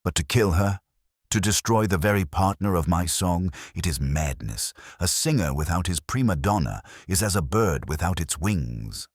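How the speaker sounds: usual speaking voice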